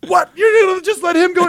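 high, outraged squeak of disbelief; can't believe she let him in. Retold or imitated. To the door lady, high outraged disbelief.

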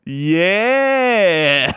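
high, long-drawn imitated whoop; mimicked one-woman cheer; mock self-cheer. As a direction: stretch it out high, cheering yourself on. Heckler answering herself, retold.

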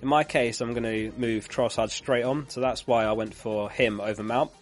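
ordinary talk, nothing marked

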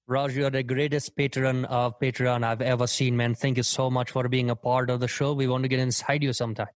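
put-on Indian accent. Guest records a shout-out for a patron, Indian accent. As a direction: thank a fan in a comic Indian accent. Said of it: "in an adopted Indian accent".

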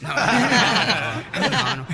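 real laughter over drawn-out no's; high, loud, laughing protest; laughing denial. Real, not acted. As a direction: laugh hard while denying it. Laughing denial of host's joke.

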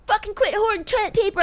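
high-pitched imitation of angry shoppers; mock outrage. Podcaster imitating everybody mad at each other, high mock-angry voice. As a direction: mimic an indignant crowd way up high. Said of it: "to have everybody, like, fucking ma-, we're all mad at each other".

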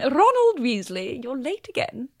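rolled R on Ronald, slow and high; Maggie impression. Actress repeating her Maggie for the host, rolled-R impression. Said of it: "I do the roll the R's again".

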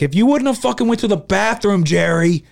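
loud, high accusation; acted-out angry blaming. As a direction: chew out a friend in mock fury. Acts out blaming a friend.

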